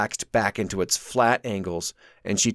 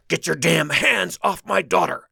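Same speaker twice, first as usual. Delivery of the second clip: furious order; 7 semitones higher, 3 dB louder than usual. A narrator's reading. Sanders furious.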